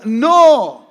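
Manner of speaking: ordinary talk, nothing marked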